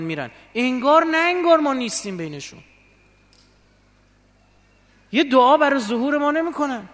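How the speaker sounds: complaint building to a high, loud outcry; aggrieved complaint. Retold or imitated. Preacher relays the Imam's grievance about hurried worshippers, reproachful. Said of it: "دیدم گلایه داره".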